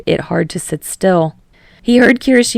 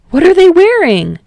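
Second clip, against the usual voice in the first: much higher, much louder eager question; excited; 12 semitones higher, 9 dB louder than usual. Acted. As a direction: perk right up with delight. Kirishima perks up.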